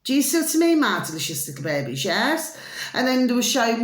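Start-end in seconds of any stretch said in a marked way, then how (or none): none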